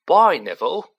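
loud call across the room. Acted. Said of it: "called Stan".

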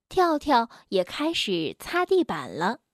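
ordinary delivery

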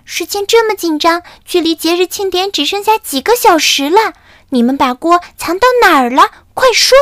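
high, loud scolding rising to a shrieked demand; angry scolding. Acted. Camelido scolds the chicks, high and angry. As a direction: demand an answer, fuming. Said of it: "卡梅利多气愤地说".

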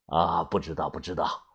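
put-on low, soft old man's reply. A narrator's reading. Old man telling the visitor he has no idea, low and subdued. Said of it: "老人摇了摇头".

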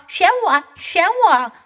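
eager cat voice. Acted. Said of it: "猫叫道".

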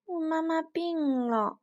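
put-on high, slow, plaintive child voice; sorrowful. A narrator's reading. Little dinosaur telling the big one about sick mother, sorrowful. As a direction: draw it out sadly as a little one. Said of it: "臭臭哒哒悲伤地回答".

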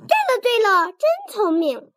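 高声连喊，开心得要跳起来; 拔高嗓门夸对方聪明; 兴奋大叫. Acted. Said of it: "我跳起来大叫". (妻子跳着高声夸丈夫猜对了)

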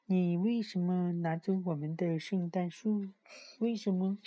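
put-on high little child asking; small child voice. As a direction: ask like a tiny toddler. Tiny child questioning.

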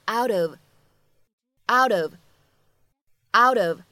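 "out of" very slow, linked with a flapped t; flap T demo. Shown as a demonstration. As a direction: glide "out of" into "ou-dof". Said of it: "闪音的处理".

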